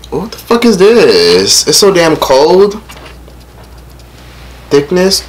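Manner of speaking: slow, high disgusted complaint. Real, not acted. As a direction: drag it out in disgust. Disgusted at the cold food.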